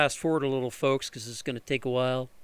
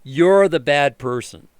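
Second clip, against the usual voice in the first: mock accusation; 11 dB louder, slower than usual. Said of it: "everybody's like".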